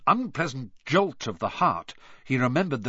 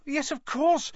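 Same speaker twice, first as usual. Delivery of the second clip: high loud frantic cry; cried; 13 semitones higher, 6 dB louder than usual. Acted. Hermione crying out in panic, high loud frantic cry. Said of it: "Hermione cried".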